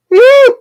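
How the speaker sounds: high, loud yelp; pained yelp. Retold or imitated. Speaker's finger caught in the machine, yelping.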